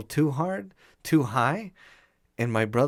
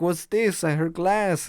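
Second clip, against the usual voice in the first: put-on grandpa's Spanish accent, a bit higher; heavy Spanish accent; 7 semitones higher, 3 dB louder than usual. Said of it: "with his heavy Spanish accent".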